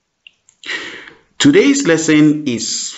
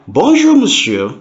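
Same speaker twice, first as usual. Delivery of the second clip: polite greeting; 8 semitones higher than usual. Pretend. Employee greets the chairman.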